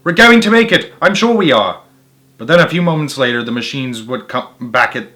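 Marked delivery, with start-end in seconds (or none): 0.0-1.8 higher, louder, urging the family on; a father fox spurring the run; hopeful cry. A narrator's reading. Mister Fox to his family, higher and louder with hope. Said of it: "Mister Fox would say".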